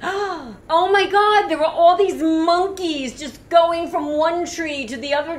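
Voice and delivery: high, slow, amazed "oh my God"; gasping in wonder at the monkeys; awestruck gasp. Retold or imitated. Tourist spotting monkeys overhead, high and awestruck. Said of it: "And we were like".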